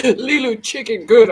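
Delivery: broken caveman words through laughter; laughing caveman talk. Retold or imitated. Vlogger repeating the movie line to viewers, laughing through it. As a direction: crack up mid-quote. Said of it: "She talked like a damn caveman".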